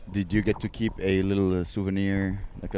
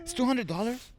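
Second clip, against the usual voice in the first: high, shocked question; surprised; 12 semitones higher, 4 dB quieter than usual. Real, not acted. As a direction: disbelief at the price. Shocked at the price.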